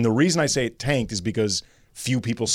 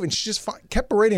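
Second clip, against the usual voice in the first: ordinary talk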